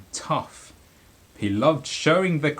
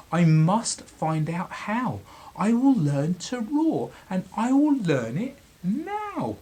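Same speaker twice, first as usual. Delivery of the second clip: higher voice, stressing will firmly; determined mouse; 5 semitones higher, 4 dB louder than usual. Acted. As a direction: mouse vowing bravely. Mouse resolves aloud.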